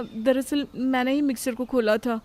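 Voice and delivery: normal talking voice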